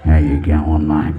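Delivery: very low, loud giant's voice; big Australian bloke. Acted. Giant Aussie greeting the comic, deep booming voice. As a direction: boom it out deep as a huge man.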